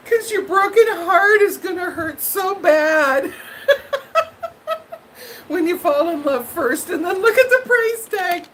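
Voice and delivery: a mock-tearful plea through laughter; laughing half-crying warning. Real, not acted. Warning viewers about price tags, laughing half-cry. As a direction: laugh through it, voice high and strained.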